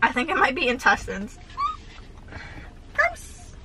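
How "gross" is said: high, squeaky "gross" of disgust; softer, higher yuck. Real, not acted. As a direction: recoil at the thought of intestines. Realizing buche might be intestines.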